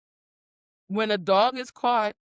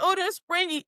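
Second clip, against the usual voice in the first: delighted discovery; 9 semitones higher than usual. Retold or imitated. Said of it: "I said".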